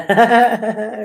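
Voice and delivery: brief amused chuckle; a quick laugh at herself; light giggle; short real laugh. Real, not acted. Laughs at her slip.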